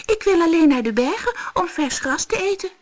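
a tiny goat answering; little goat. Acted. Little goat answers the monster in a high voice.

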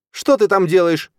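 loud, high-pitched squeal; shrieked. A narrator's reading. Stepmother catching the boy at the cradle, shrieking. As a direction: shriek it in sudden outrage. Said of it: "взвизгнула мацоха".

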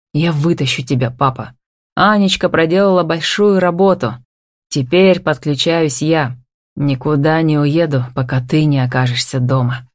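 usual speaking voice

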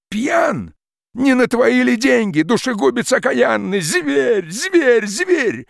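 high, loud choking rage; furious outburst. Acted. Peasant hurling words at the forester, furious shout. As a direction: fling each word at him like a blow.

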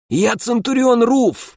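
high, slow strained rasp; hoarse rasp. Acted. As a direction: force it out through a raw throat. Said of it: "прохрипел я".

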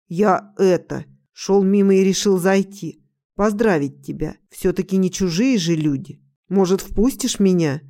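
plain reading voice

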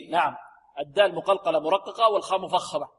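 normal talking voice

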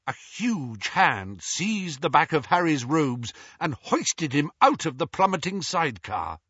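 usual speaking voice

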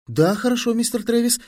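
high, cheerful answer; cheerful reply. A narrator's reading. Fili cheerfully answering his instructor. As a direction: answer brightly, pitched right up. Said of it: "весело откликнулся Фили".

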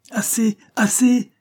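high, loud, drawn-out cut-off; angry cry. A narrator's reading. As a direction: cry out to silence him. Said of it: "cria Cornélie avec colère". (cri de colère)

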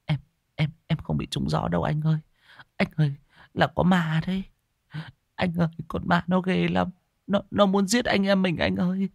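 high, stammering scared voice; frightened girl. Acted. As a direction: plead with her brother in fear. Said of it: "mà khóc nức nở".

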